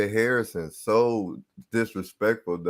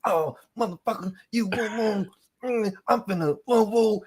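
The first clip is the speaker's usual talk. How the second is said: high, taunting woo woo chant; mock barking trash talk; 9 semitones higher than usual. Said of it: "I'm barking".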